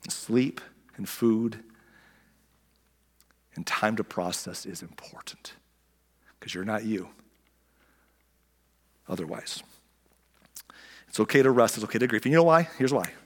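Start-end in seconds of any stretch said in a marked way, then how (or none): 0.1-9.6 soft, unvoiced whisper. Real, not acted. Pastor leaning in to the congregation, whispered. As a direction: hush it to the church.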